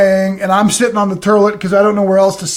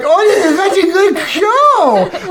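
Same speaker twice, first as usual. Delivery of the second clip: put-on high, slow little girl gushing; delighted kid; 9 semitones higher, slower than usual. Stepdaughter telling her stepdad about the show, high child voice. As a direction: gush like a small girl.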